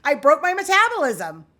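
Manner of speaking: a loud, sarcastic wail at yourself; sarcastic despair. Retold or imitated. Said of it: "I was like".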